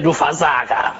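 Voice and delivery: a low, serious "Du Versager", said heavily; a grave critic's "Du Versager" as a verdict; a heavy, serious "Du Versager" from the inner critic. Shown as a demonstration. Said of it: "Ich hatte vorher eine sehr ernste Stimme".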